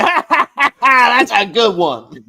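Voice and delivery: high, loud hammed-up cackle; forced laugh. Game master as the NPC boss laughing off the player's joke. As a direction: laugh it off big. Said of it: "he's like".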